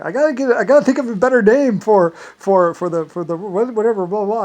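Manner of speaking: high, loud flustered voice; imitating Irish people. Irish people fretting over a better name, high and flustered. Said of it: "the Irish people were like".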